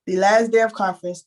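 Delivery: usual speaking voice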